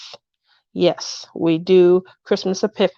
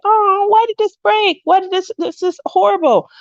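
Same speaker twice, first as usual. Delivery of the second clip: much higher, mock dismayed complaint; dismayed lament; 10 semitones higher than usual. Retelling her dismay at the broken lights, mock whiny. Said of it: "I was like".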